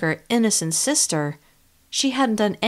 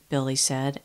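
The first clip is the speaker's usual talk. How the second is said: ordinary delivery, nothing marked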